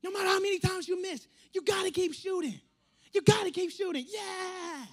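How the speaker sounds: high, loud, insistent chant; fired-up. Retold or imitated. Steph Curry celebrating in a clip, fired-up. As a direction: imitate a pumped-up player, shouting it out. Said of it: "And he says".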